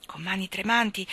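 plain reading voice

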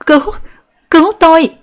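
high, loud terrified cry; desperate scream for help. A narrator's reading. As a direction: scream for your life. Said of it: "tiếng kêu gào thảm thiết".

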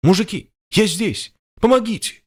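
high, desperate shout; desperate cry for help. A narrator's reading. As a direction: call for help, panicking.

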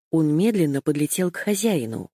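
ordinary delivery, nothing marked

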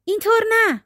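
high-pitched, lightly voiced exclamation; mild cry of protest. A narrator's reading. Witch's daughter correcting her captive on the paddle, mild high cry. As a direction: call it out high without real volume. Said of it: "دوباره دختر جادوگر فریاد زد".

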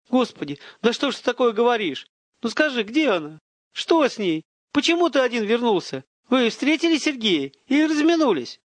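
high-pitched, desperate questioning; distraught father's high voice; frantic pleading. A narrator's reading. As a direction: fire anxious questions, voice climbing. Father frantic about his daughter.

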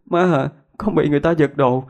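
high-pitched tearful wail; crying like a child. Acted. Daughter telling her mother the savings were stolen, high tearful wail. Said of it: "khóc như một đứa trẻ".

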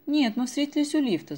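quick, high and loud rattle; girl rattling excuses to her mother. A narrator's reading. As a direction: gabble it out fast to cover up. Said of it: "затараторила Дашка".

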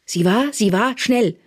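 loud, high, insistent bursts; impatient prompting. A narrator's reading. Therese pressing the informer for an answer, impatiently. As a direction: goad him to finish the sentence.

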